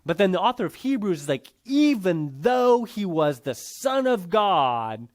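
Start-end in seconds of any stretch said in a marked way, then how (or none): 1.6-5.1 slow, weighty stress on "Even though"; emphatic. Retold or imitated. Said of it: "there it's very emphatic".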